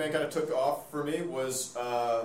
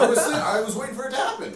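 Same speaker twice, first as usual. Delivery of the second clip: laughing; 9 semitones higher, 4 dB louder than usual. Real, not acted. Laughing while describing the scene.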